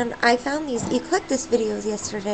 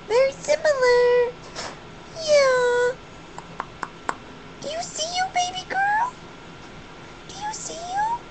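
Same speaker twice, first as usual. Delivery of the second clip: high-pitched pet talk. Real, not acted. Owner asking her baby girl if she sees herself, high-pitched pet talk.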